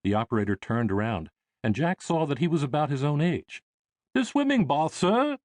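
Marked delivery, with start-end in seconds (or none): 4.1-5.4 put-on high, polite English accent; a young steward's English accent. Young English operator answering Jack in an English accent. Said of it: "he replied in an English accent".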